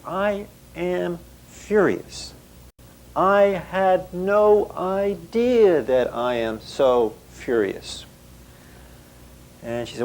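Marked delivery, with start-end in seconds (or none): 0.0-8.0 higher and slow, a hint of stunned anger; quoted client. A client telling her therapist she is furious, slow and higher. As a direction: spell out the words slowly, half-disbelieving.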